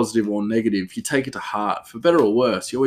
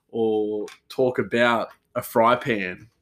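usual speaking voice

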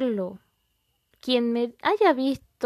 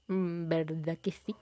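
soft conspiratorial whisper; 4 semitones lower, 6 dB quieter than usual. Real, not acted. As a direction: breathe it like a secret. Aside to the listener.

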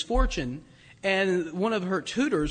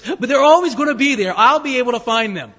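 triumphant punchline, almost shouting with joy; gleeful exclamation; 5 semitones higher, 11 dB louder than usual. Acted. Pastor jokes to congregation about finding his tools, gleeful loud outburst. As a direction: burst out louder and higher on always, gleeful.

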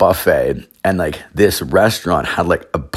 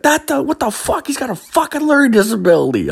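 outraged yell. Retold or imitated. Parking attendant yelling at the dad who punched his helper, high outraged yell. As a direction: screech it like you can't believe it.

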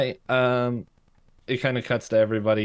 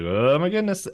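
mock surprise; 8 semitones higher, 4 dB louder than usual. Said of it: "Dipper's like".

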